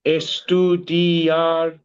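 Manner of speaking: "Estudiar" drawn out very slowly, every syllable clear; slow teaching demo. Shown as a demonstration. Tutor models the verb for the student, slow and clear. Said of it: "you must know that one".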